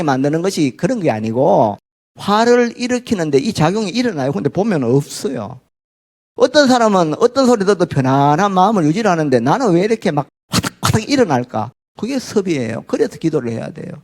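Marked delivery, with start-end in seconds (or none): none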